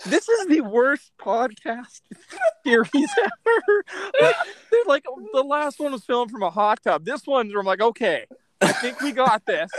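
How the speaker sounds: amused, laughing as the words come out. Real, not acted. Cracking up with co-hosts over the podcast setup, laughing. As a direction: talk through laughter.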